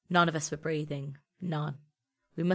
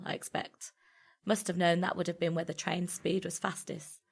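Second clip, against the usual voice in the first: ordinary delivery, nothing marked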